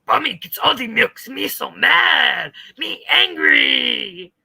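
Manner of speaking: slow, roaring tantrum voice; hammed-up loud angry caricature; mock yelling Texas girl. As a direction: bellow it like a sulking monster. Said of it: "That's what she sounds like".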